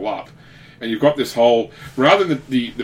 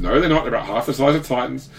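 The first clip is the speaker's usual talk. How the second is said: ordinary talk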